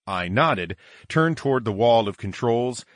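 usual speaking voice

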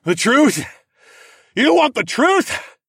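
bellow. Acted. Narrator defying the court, bellowing. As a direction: bellow it at them. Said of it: "another bellow built in the back of my throat".

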